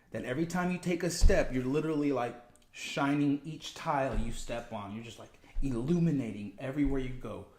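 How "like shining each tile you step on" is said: ordinary delivery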